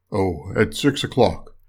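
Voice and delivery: low storybook wolf; coaxing wolf. A narrator's reading. Wolf answers.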